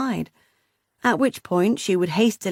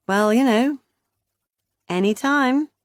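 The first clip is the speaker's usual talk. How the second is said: dry; 5 semitones higher than usual. A narrator's reading. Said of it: "Meg replied dryly".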